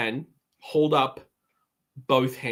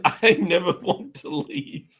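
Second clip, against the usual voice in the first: eye-rolling; 5 semitones higher, slower than usual. Acted. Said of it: "And rolls his eyes".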